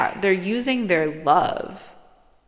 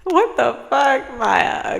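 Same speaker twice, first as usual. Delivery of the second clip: louder, slow outburst of surprise; startled shout; 8 semitones higher, 5 dB louder, much slower than usual. Real, not acted. Startled by something, calling out to Maya with a shout.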